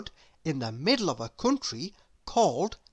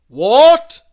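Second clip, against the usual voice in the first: very loud, high, rising shout; loud grocer's shout; shouted question; 7 semitones higher, 16 dB louder than usual. A narrator's reading. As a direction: bark it out as if hard of hearing. Deaf grocer shouts back.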